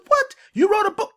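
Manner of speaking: high-pitched mock astonishment; incredulous question, pitch leaping up; astonished listener. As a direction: blurt it high, in disbelief. Voices astonished listener hearing of book.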